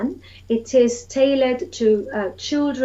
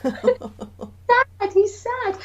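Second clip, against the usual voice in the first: put-on much higher sad child voice; children sad; 11 semitones higher, much slower than usual. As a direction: say it as a sad toddler. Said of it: "children's face going".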